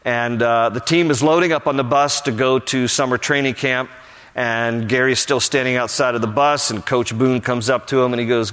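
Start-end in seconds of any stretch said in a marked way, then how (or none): none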